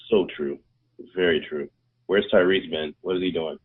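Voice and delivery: ordinary talk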